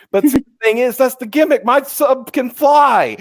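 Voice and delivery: high, loud salesman pitch; excited pitch. Acted. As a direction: sell the gimmick, slowing at the end. Pitching his submarine gimmick.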